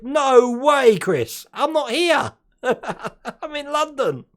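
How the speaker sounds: loud, high-pitched disbelief breaking into a laugh; real exclamation of disappointment; dismayed surprise. Real, not acted. Learns he will miss the show.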